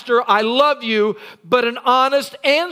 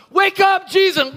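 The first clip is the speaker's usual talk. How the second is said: panicked shout; 7 semitones higher than usual. Acted. Disciples waking Jesus in storm.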